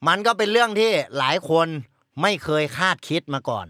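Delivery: low, hushed ghost-story telling; spooky storytelling. Acted. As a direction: draw them into a dark tale. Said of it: "นี่ไปทางดึงเลย".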